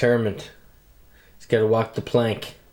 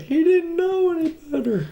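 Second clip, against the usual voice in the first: much higher mock voice; imitating the defendant. Mocking the defendant's plea to a friend in a much higher voice. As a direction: squeak it out like a tiny distant figure. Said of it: "He's like".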